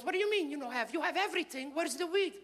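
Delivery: put-on high, accented questioning; high-pitched mock maternal demand; demanding mother. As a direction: press him as an impatient immigrant mom. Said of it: "She goes".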